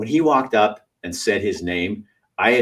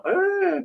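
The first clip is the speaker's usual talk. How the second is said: 1 mock gasp. Everybody gasping.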